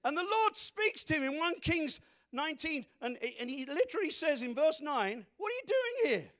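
high, loud proclamation peaking on the question; full-force preaching. Real, not acted. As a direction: build to a shouted demand. Shouts the Lord's question.

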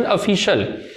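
ऑफिशियल said "uh-FISH-ul"; ऑफिशियल in three syllables, opening on schwa; reduced vowels. Shown as a demonstration. Teacher gives pronunciation, ऑफिशियल as "uh-FISH-ul". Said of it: "इसकी pronunciation".

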